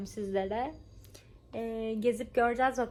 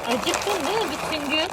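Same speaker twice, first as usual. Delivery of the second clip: high, loud pet talk; cooing at a pet; 6 semitones higher, 6 dB louder than usual. Real, not acted. Baby-talk to the cat.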